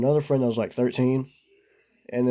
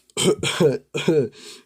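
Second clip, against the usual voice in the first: loud hacking cough; mock coughing fit; 3 semitones higher, 5 dB louder than usual. First hit of weed, loud exaggerated coughing. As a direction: cough like smoke burned the throat.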